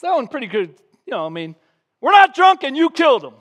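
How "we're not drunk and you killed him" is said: high, loud declaration; bold sermon quote. Retold or imitated. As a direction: hammer it home to the crowd. Quoting Peter's sermon.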